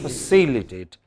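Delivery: "Facilitate" with weak FA, strong CI; stress on CI. Shown as a demonstration. Teacher showing weak unstressed syllable, stress on CI. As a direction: weaken the first syllable of "Facilitate", stress the second. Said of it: "Non-stressed syllable would become very weak".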